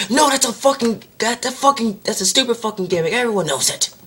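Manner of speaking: high-pitched ranting dismissal; mock indignant outburst. Vampire turning down the boss's offer, mock indignant outburst. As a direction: squawk it in put-on indignation.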